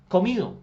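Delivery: comido punched high and loud; stress on comido. Shown as a demonstration. Teacher to class stressing the participle comido, high and loud. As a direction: hit the word comido hard. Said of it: "Comido es el participio".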